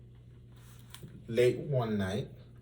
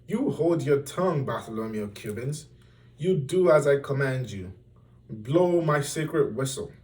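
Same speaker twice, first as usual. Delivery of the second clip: commanding king; 4 semitones higher, 6 dB louder than usual. A narrator's reading.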